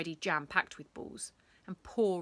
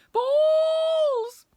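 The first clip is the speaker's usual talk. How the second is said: very high, loud drawn-out bawl; 14 dB louder than usual. Acted. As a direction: bawl it as he vanishes out the gates. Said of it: "bawled Mister Pent".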